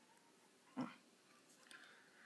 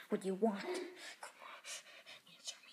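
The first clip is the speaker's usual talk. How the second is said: soft whisper to the pet; 8 dB quieter than usual. Real, not acted. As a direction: coax the cat in a whisper.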